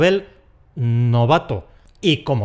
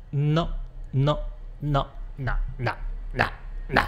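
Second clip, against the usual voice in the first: fading quiet refusal; soft repeated no; 8 dB quieter than usual. Acted. Teacher wagging a finger, soft and fading. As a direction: let the no's fade away.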